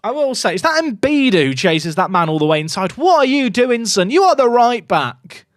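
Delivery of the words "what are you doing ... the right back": higher, louder telling-off of a wayward player; exasperated scold. Real, not acted. Streamer scolding his right back for leaving position, exasperated. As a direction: scold him like a frustrated coach.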